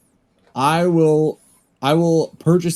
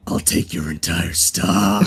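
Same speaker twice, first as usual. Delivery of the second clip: deep character voice; 8 semitones lower, 4 dB quieter, slower than usual. Acted. Player voices dragon character line.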